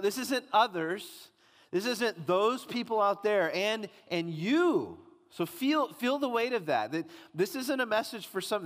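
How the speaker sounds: rising, urgent preacher's appeal; preaching at full force. Acted. Preacher urging the congregation to take it personally, impassioned.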